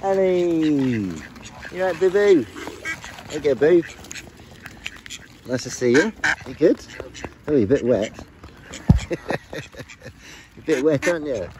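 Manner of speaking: high, loud cooing pet voice. Real, not acted. Greeting favourite birds, loud high baby-talk cooing.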